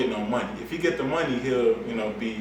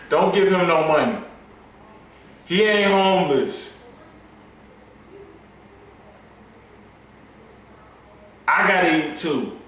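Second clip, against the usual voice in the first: louder and higher, yelling the punchline; shouting; 6 semitones higher, 7 dB louder than usual. Acted. Comedian yelling at the crowd about the beggar, loud. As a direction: belt it out like a warning.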